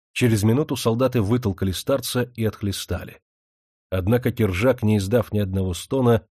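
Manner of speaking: plain reading voice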